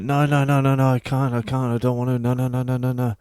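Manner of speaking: high, rattled-off mock panic; panicked refusal.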